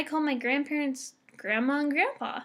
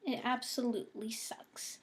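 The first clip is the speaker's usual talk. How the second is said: whisper; 9 dB quieter than usual. Real, not acted.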